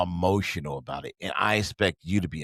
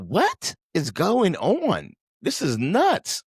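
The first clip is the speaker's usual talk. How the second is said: higher, very slow, incredulous; slow disbelief; 10 semitones higher, 4 dB louder, much slower than usual. Retold or imitated. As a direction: drag it out in total disbelief. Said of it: "I'm like".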